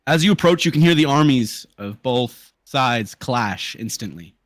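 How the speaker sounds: loud, high opening easing into slow narration; dramatic scene setting. Acted. Game master tells players of clashing armies, dramatic narration. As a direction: announce the battle like a game master.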